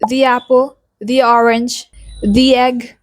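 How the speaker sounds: "the" as "thee" in "the apple, the orange"; long-ee "the" before "apple", "orange", "egg"; vowel form. Shown as a demonstration. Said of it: "sound is used before vowel sounds".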